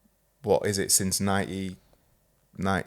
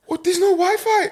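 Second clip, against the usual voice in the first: put-on much higher, louder teen complaint; mock teenage whine; 6 dB louder than usual. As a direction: whine it like a stroppy sixteen-year-old. Voicing stepdaughter in story.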